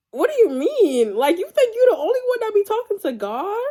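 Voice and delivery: high-pitched, scoffing disbelief; indignant, pitch leaping up; incredulous. Retold or imitated. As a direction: question him like it's absurd. Scoffing at his retold excuse.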